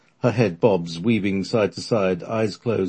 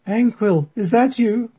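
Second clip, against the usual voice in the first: put-on old lady medium; 6 dB louder, slower than usual. Medium voice again.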